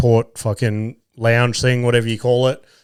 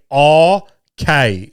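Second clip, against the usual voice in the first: "okay" stretched slowly over two long syllables; lingering "oh", then "kay"; drawn-out okay; 3 semitones higher than usual. Retold or imitated. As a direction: imitate his irritating drawled okay. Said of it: "in different fucking tones and lengths".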